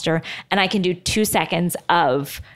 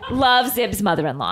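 laughing; 5 semitones higher than usual. Real, not acted. Laughs finishing the letter.

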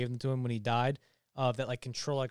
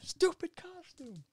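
high, softer, drawn-out squeal; mimicked villain line; 5 dB quieter, much slower than usual. Showing the way he says it, squealed imitation. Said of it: "The way he says it".

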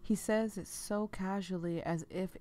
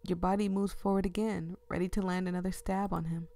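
ordinary talk

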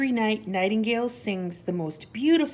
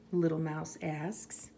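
ordinary delivery, nothing marked

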